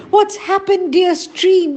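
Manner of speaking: high, loud anguished call to the stream; distressed cry. A narrator's reading. Anju cries out to her dying stream, high and loud. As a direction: cry out in alarm for a friend. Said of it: "Anju cried".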